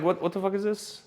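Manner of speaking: high, puzzled exclaim; mock bafflement; baffled. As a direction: react to a stray script page. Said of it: "I'm like".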